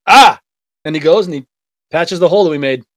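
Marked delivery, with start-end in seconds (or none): 0.1-0.4 loud, higher "Ah!" of sudden understanding; imitating a coworker's loud aha. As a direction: blurt the coworker's realising ah.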